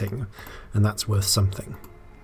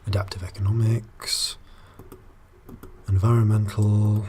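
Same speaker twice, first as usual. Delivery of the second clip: whisper; 5 dB quieter than usual. Real, not acted. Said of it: "whispering to himself".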